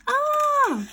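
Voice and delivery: high, loud falling scream. Acted. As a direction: scream as you fall. Boy falling toward cats.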